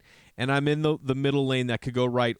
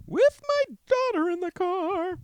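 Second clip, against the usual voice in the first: squeaky; slower than usual. Pretend. Sudden squeaky high emphasis.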